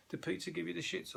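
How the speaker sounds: high, puzzled mock question; mock customer question. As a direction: play a queasy customer asking around. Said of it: "and going".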